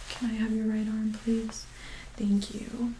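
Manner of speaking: ordinary delivery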